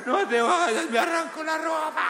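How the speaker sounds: grito agudo de niño que suplica; niño en pleno berrinche. Pretend. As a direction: llora y exagera para que mamá no se vaya. (berrinche infantil agudo y gritado)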